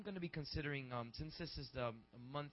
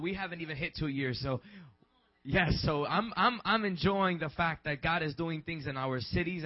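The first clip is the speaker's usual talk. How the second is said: loud, rousing preacher voice; preacher proclaiming to the church; full-force preaching; 3 semitones higher, 10 dB louder than usual. Acted. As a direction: lift the congregation with praise. Preaching to congregation.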